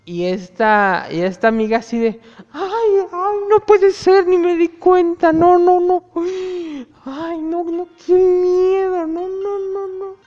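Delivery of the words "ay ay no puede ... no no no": high, squealing string of no's; mock panic. Friend realising the scarf is a snake, high squealing panic. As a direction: shriek it like you just spotted a snake.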